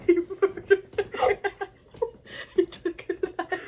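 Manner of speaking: loud, slow words through laughter; laughing hard. Real, not acted. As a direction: barely get it out while cracking up. Cracking up at the story.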